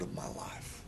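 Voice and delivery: hushed whisper of a question. Real, not acted. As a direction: whisper it intimately to the congregation. Preacher drops to searching question.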